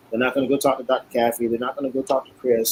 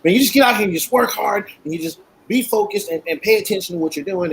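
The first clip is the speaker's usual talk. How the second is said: higher Doc voice, rephrased without the curse; quoting Doc, cleaned up; 8 semitones higher than usual. Retold or imitated. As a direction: mentor giving clean advice, pitched up. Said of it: "and he stops".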